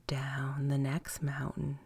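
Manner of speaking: fading whisper. A narrator's reading. Narrator whispering the long way down. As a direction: let each down drift away softly.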